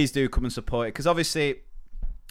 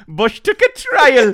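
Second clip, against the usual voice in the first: very high, loud, slow Geordie presenter; mock Dec presenting; Dec impression; 13 semitones higher, 13 dB louder, slower than usual. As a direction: Geordie telly host laughing. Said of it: "Deck doing a".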